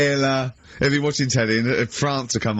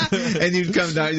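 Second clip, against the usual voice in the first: laughing; 5 semitones higher than usual. Real, not acted. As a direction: talk through laughter. Laughing while telling joke story.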